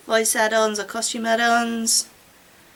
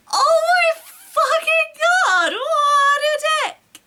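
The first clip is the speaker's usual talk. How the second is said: much higher, slow, drawn-out outraged wail; outraged shriek; 5 dB louder, slower than usual. Acted. Heroine shrieking at the rude boyfriend, drawn-out outraged wail. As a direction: wail it out, scandalized and near tears.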